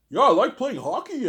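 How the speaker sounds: high, loud mock Canadian; hammed-up Canadian accent. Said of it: "they have the hardcore Canadian accent".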